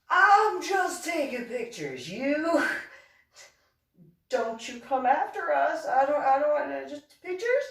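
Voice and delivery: high, hammed-up plea to an eel; high-pitched acted wariness; mock nervous pleading. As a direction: squeal it like you're harmless and nervous.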